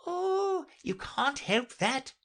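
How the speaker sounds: very high, drawn-out cat voice; airy Cheshire Cat. A narrator's reading. The cat answers Alice's objection, airily. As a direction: brush it off high and slowly.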